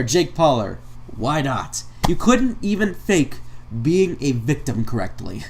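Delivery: ordinary talk, nothing marked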